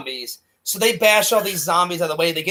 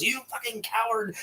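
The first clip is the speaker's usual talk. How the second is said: hushed, higher-pitched mock accusation; kept down; 5 semitones higher, 9 dB quieter than usual. Repeating Spider's insult after being shushed, kept down. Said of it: "I need to keep it down".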